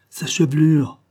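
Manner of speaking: exclaiming. A narrator's reading. Cossack at the table, exclaiming over her hair. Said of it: "s'écria le Cosaque".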